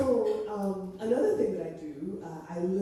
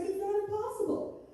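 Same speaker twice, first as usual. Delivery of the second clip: light, high and breezy; breezy high quote; 14 semitones higher than usual. Retold or imitated. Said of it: "And she's just like".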